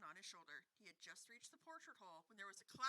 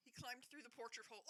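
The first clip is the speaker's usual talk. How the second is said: normal talking voice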